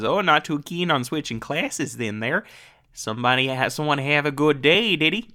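corrected take, teasing Minnesotan; Minnesota accent. Retold or imitated. Host rereads Barb's exact line to Cliff, Minnesota accent. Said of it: "that's what she says".

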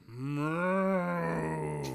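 long, loud imitation moo; loud moo swelling then falling; cow battle moo. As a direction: bellow a drawn-out moo like a cow. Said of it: "ready their weapons and go".

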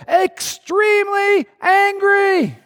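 high, slow hammed-up shout of extremely angry; mock angry shout. Preacher hamming up God's anger to congregation, slow high shout. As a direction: boom it out slow like God losing it.

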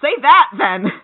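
high, loud mock yell; kids yelling. Playground kids answering Bart, loud mock yell. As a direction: imitate kids shouting back. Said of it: "And they yell".

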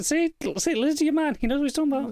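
high, hammed-up alien head voice; high pretend alien head; mock big head voice. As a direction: play the giant head, high and smug. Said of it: "Yeah, it's like".

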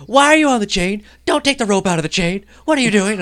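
high, loud panicked staff guy; alarmed yell. Retold or imitated. Gym worker shouting at the climber on the chain, alarmed. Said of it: "and the guy's like".

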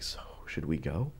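hushed, breathless whisper asking to leave; whispered question. Retold or imitated. To a friend beside a parked cop, whispered. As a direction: whisper it so the cop next door can't hear. Sneaking away from cop car.

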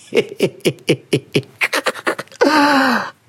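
high staccato laughing fit; long helpless laugh. Real, not acted. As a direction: laugh till the breath gives out. Laughs at the story.

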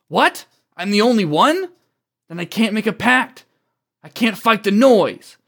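high-pitched, incredulous protest; acted dismay at being alone; alarmed disbelief. Character learns he is alone.

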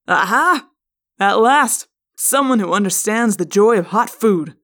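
loud, delighted father's exclamation; triumphant cry. Acted. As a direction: crow over a fellow fan of hot food. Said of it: "Cassie's father cried".

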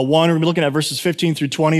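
ordinary talk

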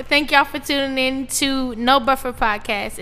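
ordinary delivery

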